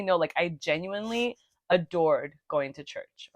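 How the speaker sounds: ordinary talk, nothing marked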